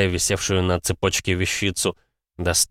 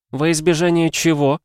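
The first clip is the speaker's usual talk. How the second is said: female character voice; 9 semitones higher, 4 dB louder than usual. Acted. Steini voiced higher.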